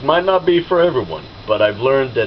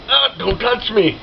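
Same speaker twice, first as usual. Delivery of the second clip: recoiling; 10 semitones higher than usual. Retold or imitated. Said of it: "I'm like".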